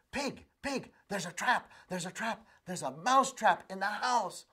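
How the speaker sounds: high, urgent little mouse warning; frantic mouse. Acted. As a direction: squeak out the alarm, breathless.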